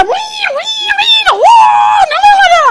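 very high, loud wild squealing; crazy woman wailing. Retold or imitated. Preacher mimics her crazy self on stage, wild squealing. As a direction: shriek nonsense noises like someone gone wild. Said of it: "I watched this crazy Maori woman".

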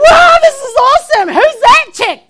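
high, loud awestruck shout; joyful amazement. Retold or imitated. Watching herself preach under God's joy, thrilled. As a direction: cheer it out in overjoyed wonder. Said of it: "under the joy of the Lord".

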